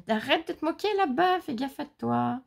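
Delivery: high, indignant playful warning; mock threat. Real, not acted. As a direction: tell off a teaser, drawing out the end. Playfully warns the companion mocking her.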